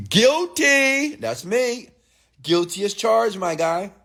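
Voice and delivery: high, mock-proud admission; playful confession. Owning up to the G-Wagon for a gym guy, high and playful. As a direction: own it with a grin in the voice. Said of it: "And I'm like".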